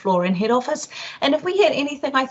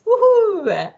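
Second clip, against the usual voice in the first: sudden high woohoo; excited cheer; 10 semitones higher, 6 dB louder than usual. Acted. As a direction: cheer it out, bright and loud. Mock cheer.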